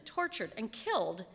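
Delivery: usual speaking voice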